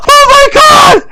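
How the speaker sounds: very high, loud scream of fright; terrified shriek. Real, not acted. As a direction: scream it at a jump scare. Jump scare in the game.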